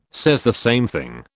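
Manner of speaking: ordinary talk, nothing marked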